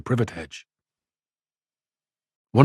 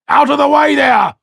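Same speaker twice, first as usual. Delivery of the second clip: much higher, much louder bawl; 7 dB louder than usual. A narrator's reading. Coachman bawling at the crowd to clear the lane, loud shout. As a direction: clear the road, shouting from up high. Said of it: "bawled a coachman".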